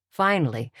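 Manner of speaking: plain reading voice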